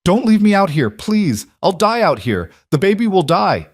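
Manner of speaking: terrified scream for mercy; frantic pleading. A narrator's reading.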